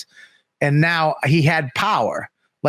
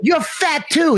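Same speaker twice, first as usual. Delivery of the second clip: put-on much higher, whiny kid retort; high-pitched mock whine; mock whiny comeback; 12 semitones higher, slower than usual. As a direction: squeak back like a bullied kid. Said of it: "and I'd be like".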